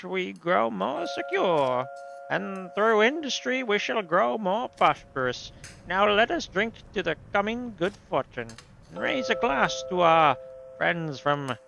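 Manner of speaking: put-on drawn-out grandiose speech in a European accent. Host raising a glass to his guests, stately put-on accent. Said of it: "I'm giving him that accent".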